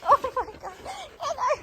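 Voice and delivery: high squeal of hello to the dog; excited greeting. Real, not acted. Greeting her dog on coming home, squealing high. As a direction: gush over her dog, voice shooting way up.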